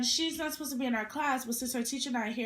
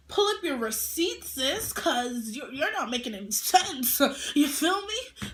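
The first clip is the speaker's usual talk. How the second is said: calling out; 3 semitones higher, 6 dB louder than usual. Real, not acted. Calling out classmate.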